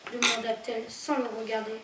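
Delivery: ordinary delivery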